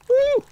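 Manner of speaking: very high, loud woo; high whoop. Real, not acted. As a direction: let out a sudden shrill-pitched whoop. Cheering in the game.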